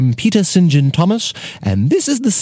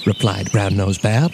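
usual speaking voice

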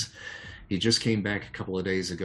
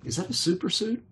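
much higher, rising questions, faster at the end; excited disbelief; 13 semitones higher, 3 dB louder, faster than usual. Acted. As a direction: pepper him with amazed questions. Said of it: "he's like".

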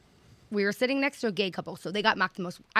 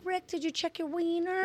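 high, soft mock-worried question; mock anxious check; 8 semitones higher, 7 dB quieter than usual. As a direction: fret at Rick in a small high voice. Imagines nagging Rick with worry.

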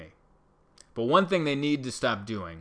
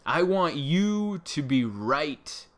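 slow, higher I want YOU to be right, YOU drawn out; stress on you; 4 semitones higher, 4 dB louder, much slower than usual. Shown as a demonstration. Repeating it slowly to the commenter, YOU stressed. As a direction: lean hard on YOU, slowly. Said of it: "so I'll repeat it".

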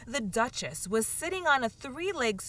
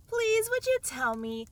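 timid; 12 semitones higher than usual. A narrator's reading. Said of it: "a little timidly".

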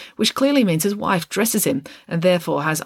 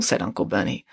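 plain reading voice

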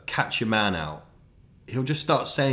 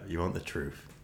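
grave confession; 4 semitones lower, 8 dB quieter than usual. Retold or imitated. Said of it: "I was like".

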